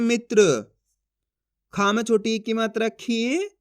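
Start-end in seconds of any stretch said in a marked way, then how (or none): 0.0-0.7 "मित्र" with a brief, unstretched i; short vowel. Shown as a demonstration. Teacher confirming the correct reading, "मित्र" with short i. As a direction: cut the i of "मित्र" short before tr. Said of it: "कैसे पढ़ेंगे?".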